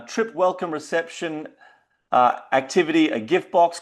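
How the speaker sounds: ordinary talk, nothing marked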